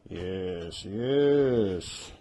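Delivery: normal talking voice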